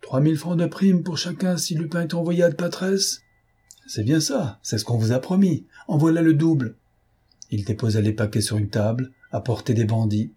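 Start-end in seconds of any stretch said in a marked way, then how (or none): 0.0-6.8 high-pitched, unruffled announcement; calm declaration. A narrator's reading. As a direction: declare the offer coolly, pitch held up. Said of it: "et tranquillement, il déclarait".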